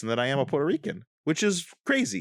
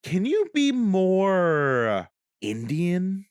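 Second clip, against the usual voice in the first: slow, drawn-out mock casting director asking for more accent; 6 semitones higher, much slower than usual. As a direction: stretch it out like a clueless casting director. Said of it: "they'd be like".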